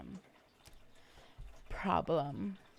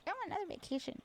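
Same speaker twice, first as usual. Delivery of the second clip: much higher, whiny "I want another vacation"; whiny child; 13 semitones higher, 5 dB quieter, much faster than usual. Retold or imitated. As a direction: whine like a kid wanting more. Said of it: "And I'm like".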